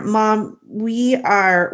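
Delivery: ordinary delivery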